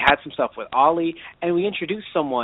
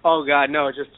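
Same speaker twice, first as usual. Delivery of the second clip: usual speaking voice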